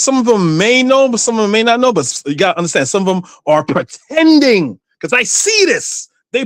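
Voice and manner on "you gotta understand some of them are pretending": normal talking voice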